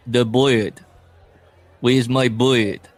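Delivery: hammed-up Russian accent, slow and loud; mock a bad movie Russian accent. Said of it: "his stupid Russian accent".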